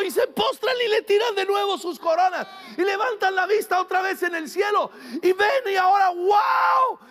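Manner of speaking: carried away. Real, not acted. Pastor describing heaven's worship to the church, ecstatic shouting. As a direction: get swept up picturing heaven's worship. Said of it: "Perdón, me emocioné".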